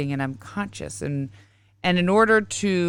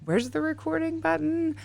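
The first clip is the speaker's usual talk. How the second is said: higher, softer mock confusion; confused self; 10 semitones higher, 5 dB quieter than usual. Host imitating herself hunting for the record button, mildly confused. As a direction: lightly act lost looking for the button. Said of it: "I'm like".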